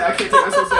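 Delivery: squealing high and loud through laughter; real laughing fit. Real, not acted. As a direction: crack up mid-sentence. Friends cracking up over a mishearing.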